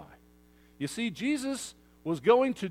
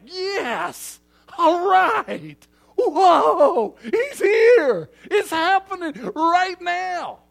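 whooping, high, loud crowd cheer with a laugh; ecstatic crowd; 12 semitones higher, 12 dB louder, much slower than usual. Retold or imitated. Preacher voicing the crowd greeting Jesus, thrilled shouting. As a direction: cheer like a thrilled crowd seeing him arrive. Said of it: "these people are pumped".

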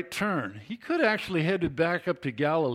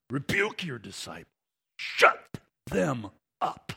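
intense demand. Acted. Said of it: "stated in a very intense tone".

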